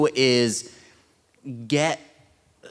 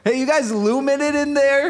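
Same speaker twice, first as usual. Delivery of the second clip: cranky neighbor calling out; 10 semitones higher than usual. Pretend. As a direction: yell at the people next door. Calling out to noisy room.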